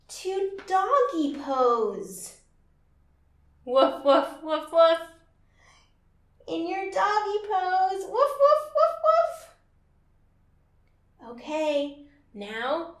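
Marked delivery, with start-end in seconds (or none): 3.6-5.0 playful barks, louder; dog barking. Pretend. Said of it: "this first pose sounds like this". 8.0-9.5 much higher, louder puppy barks; high dog barking. Pretend. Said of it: "this first pose sounds like this".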